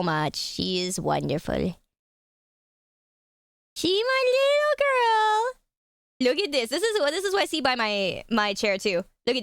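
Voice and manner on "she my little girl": cooing pet talk. Real, not acted. Owner doting on her little cat, cooing baby talk. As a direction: coo it high and drawn out.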